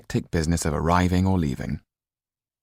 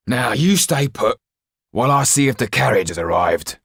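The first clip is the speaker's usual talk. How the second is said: gruff jailer's order; 7 semitones higher, 6 dB louder than usual. Acted. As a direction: bark the order down at the boy. Jailer orders the boy to stay.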